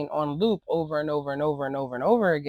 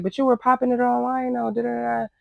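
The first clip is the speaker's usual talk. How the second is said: much higher mock of Lex; imitating Lex; 8 semitones higher, faster than usual. Recapper mimics Lex calling her out, higher and mocking. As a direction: toss it off in a higher, dismissive mock voice. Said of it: "Lex was like".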